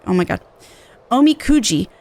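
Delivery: normal talking voice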